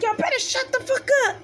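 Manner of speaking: high-pitched mock stern warning; brother's threat. Brother warns the crying girls to stop, mock stern. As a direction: tell them to hush, mock stern. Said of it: "He's like".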